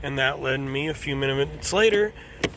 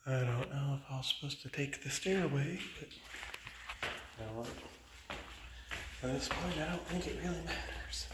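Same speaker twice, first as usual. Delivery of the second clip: hushed; 13 dB quieter than usual. Real, not acted. Walking into an office building.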